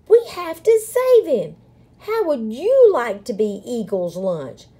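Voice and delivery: high, loud urgent appeal; yelling. A narrator's reading. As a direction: yell to rally the crowd. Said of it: "yelled Pip".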